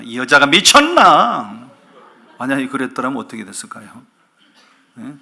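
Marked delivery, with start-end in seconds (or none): 0.0-1.7 loud, drawn-out mock outburst; outraged husband. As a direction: explode at your wife in disbelief. Husband's imagined outraged reply. (어이없어 버럭 소리치는 남편 흉내)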